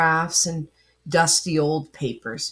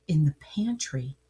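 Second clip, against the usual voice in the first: hushed whisper; 4 semitones lower, 6 dB quieter than usual. A narrator's reading. Whispered suspense.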